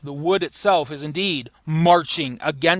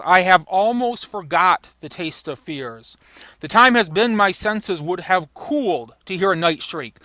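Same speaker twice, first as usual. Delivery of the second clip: dramatic Shakespeare reading; 3 semitones higher, 3 dB louder than usual. Acted. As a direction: read it like a hardened soldier. Reading Macbeth's speech.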